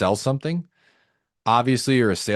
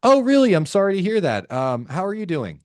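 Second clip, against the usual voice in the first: bright-pitched curiosity sliding down to the question; misplaced curious tone; 6 semitones higher than usual. Shown as a demonstration. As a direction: sound chirpily interested at bad news. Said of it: "with a curious tone".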